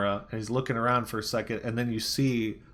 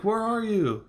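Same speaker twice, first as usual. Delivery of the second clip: high-pitched mock-frantic question; retold frantic call; 11 semitones higher, slower than usual. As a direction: imitate a man searching for a trapped friend. Retold frantic exchange through a wall.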